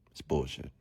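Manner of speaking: ordinary delivery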